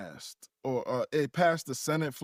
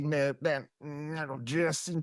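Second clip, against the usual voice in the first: put-on Southern accent on request; slower than usual. Asked by the host, performs Bubba in a hint of a Southern accent. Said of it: "could we hear Bubba's Southern maga country accent".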